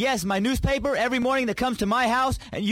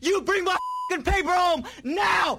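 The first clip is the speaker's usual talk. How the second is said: angry yelled demand; shouting; 7 semitones higher than usual. Acted. Prank call escalating threats.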